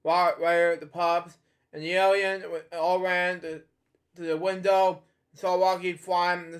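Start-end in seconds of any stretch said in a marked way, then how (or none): none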